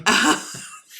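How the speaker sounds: loud burst of laughter. Real, not acted. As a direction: laugh out loud, sudden and high. Laughs before answering a question.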